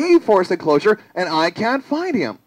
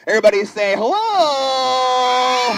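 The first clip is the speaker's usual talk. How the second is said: higher-pitched, long-held greeting call; 4 semitones higher than usual. Acted. Performer getting children to greet, calling out. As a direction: sing out the last word and hold it. Rallying audience to say hello.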